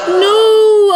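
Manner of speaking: loud, high dismayed no; shocked cry. Real, not acted. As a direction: cry out as the bad news lands. Family member dies in game.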